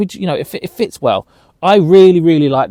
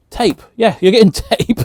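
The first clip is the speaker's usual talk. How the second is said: laughing; 10 semitones higher, 6 dB louder than usual. Real, not acted. Laughs after forgetting word.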